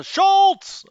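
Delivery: high, loud burst of a sitcom name; Schultz shout. Retold or imitated. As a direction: bellow the name like a TV catchphrase. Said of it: "Hogan's Heroes".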